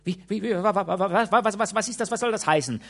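high, loud stuttering alarm; shocked stammer. A narrator's reading. Old maid seeing the lady on the sofa, shocked stammer. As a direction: stutter in disbelief.

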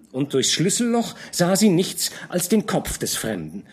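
usual speaking voice

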